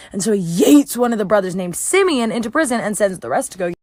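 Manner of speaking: ordinary talk, nothing marked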